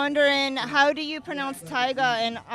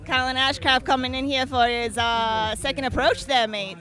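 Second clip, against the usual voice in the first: louder mock Australian commentary; hammed-up Aussie announcer calling it; Australian accent; 8 dB louder than usual. Co-host calling the run in mock Australian accent. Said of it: "try to do our Australian accents".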